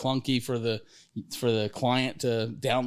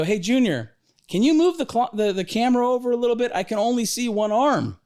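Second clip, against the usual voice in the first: much higher and louder, fast mock dad voice; dad calling to son; 9 semitones higher, 4 dB louder than usual. Dad on the video link asks his son to move the camera, a high, fast, louder mock voice. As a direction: call over the video link to your son, rushed. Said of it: "And you go, you go".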